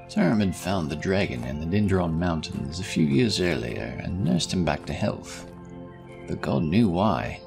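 ordinary delivery, nothing marked

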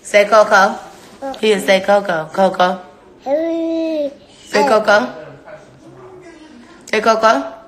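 high, loud coaxing. Real, not acted. Mother teaching her baby to say Coco.

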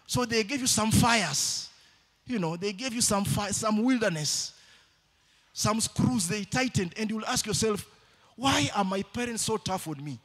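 loud, high sermon cry to the church; preaching at full force. Acted. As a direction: preach it out, pushing every word.

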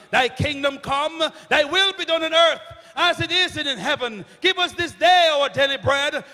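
usual speaking voice

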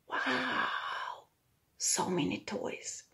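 plain reading voice

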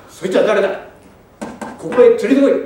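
loud rough order; demanding shout. Retold or imitated. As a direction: bark the demand at the headman. Villain quoted demanding.

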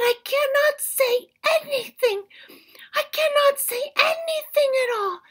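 high, loud strained squirrel with a gasp; high squeaky strained plea; squashed squirrel pleading. Acted. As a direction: plead breathlessly under the paw.

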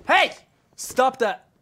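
loud high yell of Hey! then a firm order; sharp yell. Real, not acted. Streamer yelling at a misbehaving customer, loud scolding. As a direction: snap at them to knock it off.

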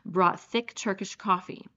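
ordinary delivery, nothing marked

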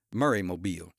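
"Murray Mobile" run together as a single word; run as one word. A narrator's reading. Narrator showing how the man says it, "Murray Mobile" as one word. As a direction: say "Murray Mobile" with no break between the words. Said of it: "said Murray Mobile as one word".

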